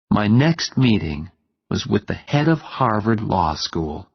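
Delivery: usual speaking voice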